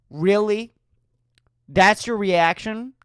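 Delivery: ordinary talk, nothing marked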